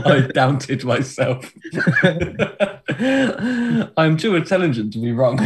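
laughing through the words; helpless giggles between phrases. Real, not acted. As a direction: crack up while talking. Cracking up at own joke.

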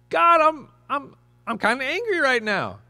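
high, loud halting confession; admitting anger. Retold or imitated. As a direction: let the anger spill out, then hold back. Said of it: "get it out and talk to him".

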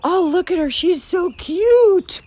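high, loud and drawn-out cooing; gushing. Retold or imitated. Gushing over a puppy photo, high and stretched out. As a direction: swoon over a cute puppy, slow and high. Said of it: "I go".

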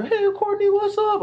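high-pitched mock greeting; imitating friends calling out. Friends call out to him.

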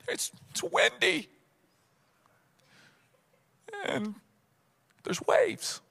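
small, high, quiet mock-scared voice; timid. Disciples explaining themselves to Jesus, sheepishly scared. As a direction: squeak out a meek excuse.

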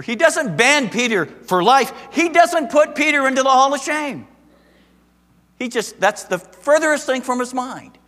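fiery sermon peak; preaching at full force. Acted. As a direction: build to a pulpit climax.

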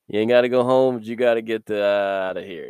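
hammed-up order to clear the room; preacher voicing Jesus telling the scoffers to leave; playful loud shove-off.

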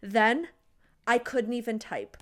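ordinary talk

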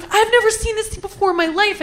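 retold high, frantic denial; freaking out. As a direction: protest innocence in a mock-panicked high voice. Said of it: "I'm freaking out".

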